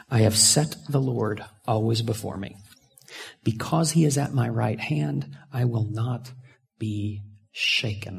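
measured, reverent verse reading; scripture reading. Acted. Preacher reading the psalm to congregation, reverent. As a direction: read it as sacred text.

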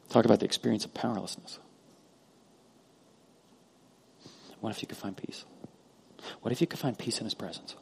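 very soft, gentle appeal; hushed preaching. Real, not acted. Pastor to hurting congregation about finding peace, hushed. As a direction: drop right down, almost confiding.

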